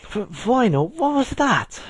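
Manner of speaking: very high, stammering British alarm; frightened squeak. A narrator's reading. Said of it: "Octavia squeaked".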